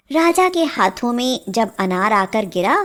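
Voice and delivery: plain reading voice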